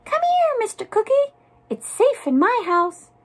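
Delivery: very high, squeaky mouse voice; high-voiced storybook mouse beckoning; mouse crying out. A narrator's reading. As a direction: call it out in a tiny high pitch. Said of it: "the mouse cried".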